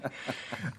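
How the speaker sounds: breathy little laugh; short amused laugh; soft laugh. Real, not acted. Laughs at the joke.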